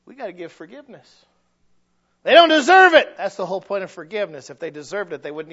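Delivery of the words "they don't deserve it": loud, high protest; indignant objection. Acted. Preacher voicing a resentful listener refusing forgiveness. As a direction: snap it back like a resentful listener.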